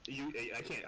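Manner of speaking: ordinary delivery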